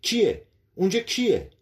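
loud, high-pitched panicked challenge; terrified cry. A narrator's reading. Startled professor challenging someone hidden by the wall, terrified. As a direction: cry out in sudden terror. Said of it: "با صدای وحشت زده گفت".

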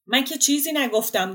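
loud, high-pitched flustered denial; defensive protest. Acted. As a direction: protest your innocence, voice jumping up. Flustered friend protests his innocence. (اعتراض دستپاچه با صدای بلند و زیر)

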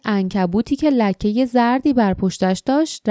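ordinary delivery

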